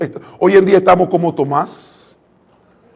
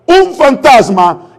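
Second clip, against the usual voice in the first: mock frightened cry; 6 semitones higher, 5 dB louder, much slower than usual. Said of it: "ellos gritaron".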